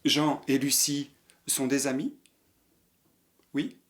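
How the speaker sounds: normal talking voice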